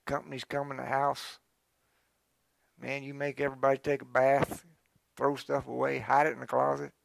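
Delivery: normal talking voice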